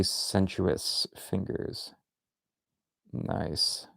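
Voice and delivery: slow, breathy-sounding hushed whisper; hammed-up seductive whisper; mock sultry whisper. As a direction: whisper it like a sly tease. Mock-seductive drawing commentary.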